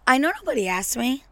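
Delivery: ordinary talk, nothing marked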